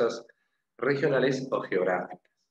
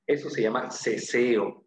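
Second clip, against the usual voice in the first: ordinary delivery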